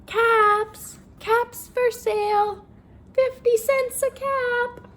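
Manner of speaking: high, loud, slow street cry; peddler calling out. Acted. Peddler walking to town calling out his caps for sale. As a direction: call your wares across the street. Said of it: "he walked back to town calling".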